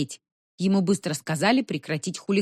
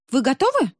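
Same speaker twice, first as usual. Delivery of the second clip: businesslike; 7 semitones higher, 4 dB louder than usual. A narrator's reading. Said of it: "деловито спросила Нинсанна".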